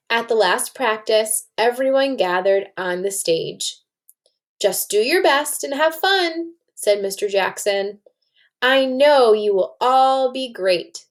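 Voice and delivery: lively read-aloud to a class, voicing the kids; story narration. A narrator's reading. Teacher reading a story to her class, lively read-aloud. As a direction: read it like a picture book to children.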